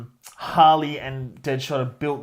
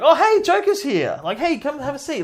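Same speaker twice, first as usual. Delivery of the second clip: much higher, breezy and unbothered; mock casual welcome; 9 semitones higher, 3 dB louder than usual. Gang greeting the Joker too casually, breezy mock cheer. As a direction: greet the Joker like an old friend dropping by. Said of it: "It's like".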